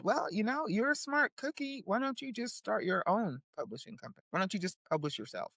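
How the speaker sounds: little inner voice. Retold or imitated. Little voice in her head nudging her, softly coaxing. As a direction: coax gently, as a small voice inside. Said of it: "the little voice in the back of my head said".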